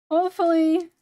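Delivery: Hopefully! shouted out loud; loud exclaim. Shown as a demonstration. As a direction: belt out Hopefully! with force.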